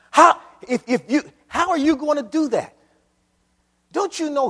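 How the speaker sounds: loud, high, full-force preaching cry; rising preacher's shout. Real, not acted. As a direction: lift it to a shout at the congregation.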